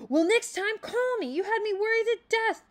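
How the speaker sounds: upset. A narrator's reading. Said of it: "beginning to cry".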